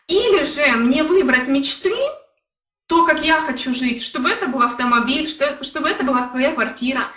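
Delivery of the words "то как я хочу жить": normal talking voice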